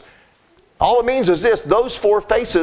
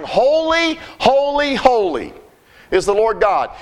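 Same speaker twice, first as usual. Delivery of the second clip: crying holy; 3 semitones higher, slower than usual. Retold or imitated. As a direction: call out holy like heavenly beasts. Beasts crying holy to God.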